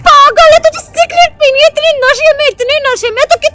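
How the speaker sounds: high-pitched, heated telling-off; angry scolding. Acted. Sister-in-law scolding the drunk girl, angry. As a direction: lay into her, starting high and easing down. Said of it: "आप क्यों इतना गुस्सा कर रही हो".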